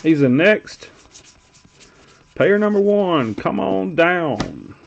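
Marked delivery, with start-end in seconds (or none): 2.3-4.8 slow, drawn-out announcer call, pitched higher; game-show announcer. Pretend. Host calling up the next player, game-show announcer style.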